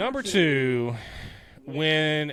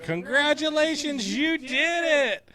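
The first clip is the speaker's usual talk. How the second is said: very high, gushing congratulations; elf cheer. Retold or imitated. Acting out Buddy cheering the coffee shop, very high. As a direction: squeal it like a thrilled grown-up elf. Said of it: "he's just like".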